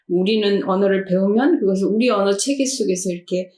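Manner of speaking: usual speaking voice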